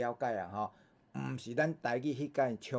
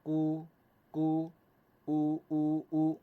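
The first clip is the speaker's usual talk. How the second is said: higher, sustained model chant for repetition; teacher's reading drill; 4 semitones higher, much slower than usual. Shown as a demonstration. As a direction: lead the class in even, held vowels. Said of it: "来，搁一摆哈".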